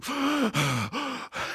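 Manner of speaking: panicked panting, imitated; nightmare gasps. Someone waking from a nightmare, imitated startled gasps. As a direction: gasp as if jolted out of a nightmare. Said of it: "you're like, "Boom, boom".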